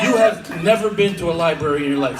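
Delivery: usual speaking voice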